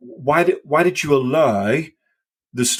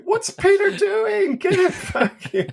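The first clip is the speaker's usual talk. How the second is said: panicky disciple. Retold or imitated. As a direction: squeal like a scared disciple in the boat. Mocking the disciples in the boat.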